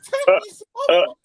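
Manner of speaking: mock the watch in a high squeal. Mocking the tacky watch, high squeaky voice.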